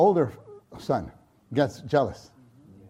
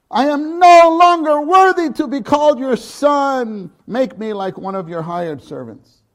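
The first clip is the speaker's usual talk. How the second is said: high, loud pleading son; begging father for mercy; 10 semitones higher, 9 dB louder than usual. Acted. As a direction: cry out like the repentant son. Preacher voicing prodigal son's plea.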